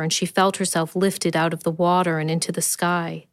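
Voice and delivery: plain reading voice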